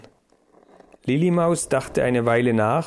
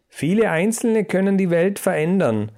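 usual speaking voice

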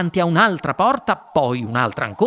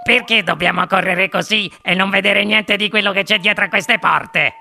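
put-on complaining child, pitch raised; Veruca Salt; 4 semitones higher, 4 dB louder than usual. A narrator's reading. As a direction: protest like a demanding child. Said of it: "chiese Veruca Salt". (bambina che protesta con voce lamentosa)

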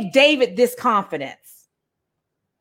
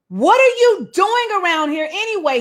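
high-pitched, scolding big brother; older brother telling off the younger one; angry; 8 semitones higher than usual. Acted. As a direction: demand it, acted-out irritation. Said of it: "he was angry".

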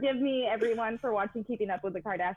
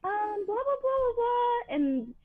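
whiny ex impression; 12 semitones higher than usual. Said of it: "she called me and she's like".

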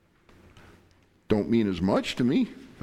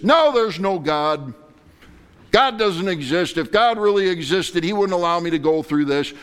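bitter outburst; 7 semitones higher, 6 dB louder than usual. Retold or imitated. Voicing bitter unbelief.

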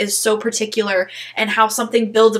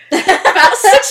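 exasperated; 8 semitones higher, 7 dB louder, much slower than usual. Real, not acted. Frustrated recounting, correction.

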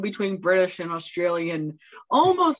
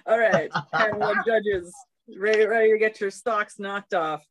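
usual speaking voice

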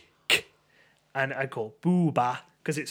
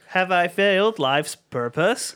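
toy robot voice; 4 semitones higher, 6 dB louder, slower than usual. Pretend. Robot role-play after spilling beer.

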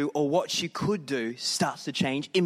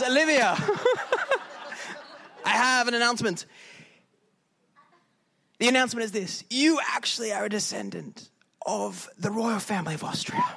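hammed-up Austrian accent; 7 semitones higher than usual. Austrian stranger announcing royal news to Olivia, mock Austrian accent. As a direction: overdo the Austrian accent with a giggle. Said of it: "my accents go weird".

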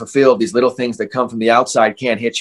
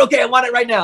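a pitched-up mock of an eager rival; mocking high voice; 11 semitones higher, faster than usual. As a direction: squeak out the sudden change of heart. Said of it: "And then they go".

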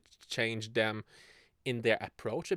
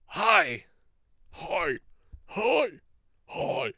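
halting, breathy old-man "Hi"s, a bad imitation; badly faked Biden greeting; Joe Biden; 8 semitones higher, 6 dB louder, much slower than usual. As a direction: do a shaky Joe Biden hello. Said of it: "I'm trying to do Joe Biden".

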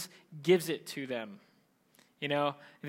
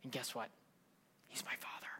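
very soft, dropped voice; hushed reveal; 5 semitones lower, 15 dB quieter, faster than usual. Acted. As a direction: let it out almost in a whisper. Jesus quietly reveals his Father.

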